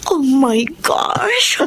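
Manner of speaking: high, bursting excitement; thrilled, high-pitched gush; excited. Acted. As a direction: squeal it out like a dream come true. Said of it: "I am so excited".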